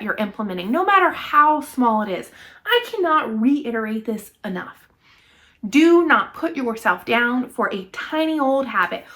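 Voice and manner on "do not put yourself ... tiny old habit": ordinary talk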